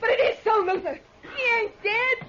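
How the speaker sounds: frantic hysteria as she insists; high sobbing laugh, slowing at the end; hysterical. Acted. Insisting the dead man returned.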